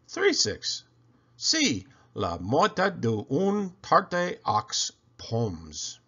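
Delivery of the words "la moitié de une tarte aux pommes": slow, careful French words; French phrase. Pretend. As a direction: lay out the French slowly, like a quiz option. Quiz option read in French.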